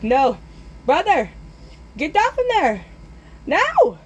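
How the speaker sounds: high, urgent calling up, climbing in pitch; urgent yell. Retold or imitated. Boy calling brother down from burning tree, urgent yelling.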